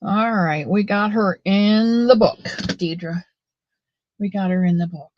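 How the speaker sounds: ordinary talk, nothing marked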